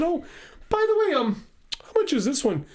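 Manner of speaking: high, hesitant asking the price; timid price question. Retold or imitated. Teen asks the store lady how much the piano costs, high and hesitant.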